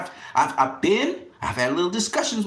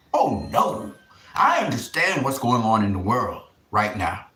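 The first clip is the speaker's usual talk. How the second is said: ordinary talk, nothing marked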